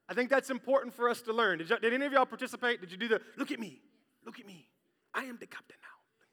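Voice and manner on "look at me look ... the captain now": whispered pirate line; whispered Captain Phillips. Retold or imitated. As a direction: hush it like a secret. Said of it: "I'm having way too much fun with that".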